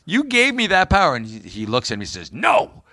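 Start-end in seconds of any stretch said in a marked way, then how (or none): 0.1-1.2 much higher, put-on indignant voice; acted protest. Acting out the Dallas father-son power argument, mock indignant protest. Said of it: "he goes whining to him". 2.3-2.8 high, put-on angry refusal; pissed off. Jock Ewing refusing his son, mock angry outburst. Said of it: "he's really pissed off".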